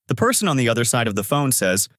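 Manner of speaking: plain reading voice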